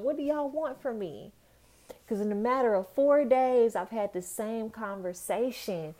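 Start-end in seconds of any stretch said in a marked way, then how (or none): 0.0-1.3 exasperated question. Retold or imitated. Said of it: "I asked this question".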